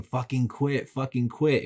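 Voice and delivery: half-yelled urging, like a viewer at a movie; mock yell at the screen. Viewer telling the hero to quit, mock yelling. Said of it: "would you be yelling".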